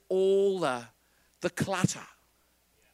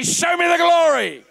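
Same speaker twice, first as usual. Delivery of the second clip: loud, much higher shouted line; shouted call; 9 semitones higher, 6 dB louder than usual. Shown as a demonstration. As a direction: rouse the crowd to shout it. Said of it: "Turn to your neighbor and say".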